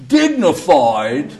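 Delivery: indignant question. Retold or imitated. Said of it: "And he said".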